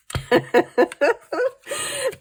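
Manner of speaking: a high squealing burst of laughter; real high giggle fit. Real, not acted. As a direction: laugh helplessly at dad. Laughing at dad.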